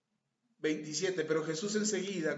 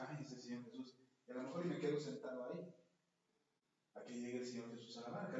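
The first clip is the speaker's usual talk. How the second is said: lazy mumble; 5 semitones lower, 9 dB quieter than usual. Pretend. Preacher imagining himself lazy.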